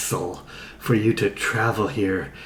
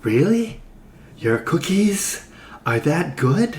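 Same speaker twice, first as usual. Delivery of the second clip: high disbelieving question; surprised; 7 semitones higher than usual. Acted. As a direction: sound amazed, pitch up. Greg astonished.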